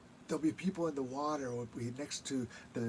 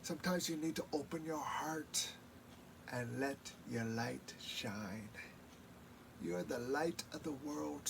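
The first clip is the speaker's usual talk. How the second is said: grandma's words; 8 dB quieter than usual. Retold or imitated. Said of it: "she'd go".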